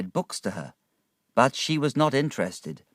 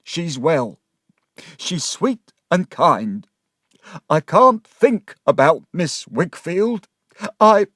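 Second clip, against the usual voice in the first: slow and pitched up, building in distress; overcome, growing louder and higher; voice changed, near tears; 7 semitones higher, 8 dB louder, slower than usual. Acted. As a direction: struggle to speak kindly of her, then break off. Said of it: "and his voice changed".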